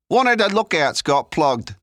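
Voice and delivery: loud, high-pitched street lad; Leon, urgent. Acted. Figure in narrated story.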